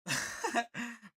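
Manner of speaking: high, loud burst of laughter. Real, not acted. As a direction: laugh hard at the page. Streamer laughs aloud again.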